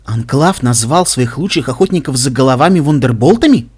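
ordinary delivery, nothing marked

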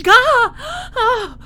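high, loud pained cries; cry of pain. Acted. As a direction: break off into sudden cries. Captive hurt mid-sentence.

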